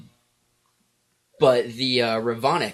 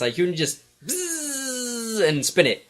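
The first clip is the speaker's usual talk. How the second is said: buzzing imitation; 10 semitones higher, slower than usual. Shown as a demonstration. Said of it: "what does it sound like".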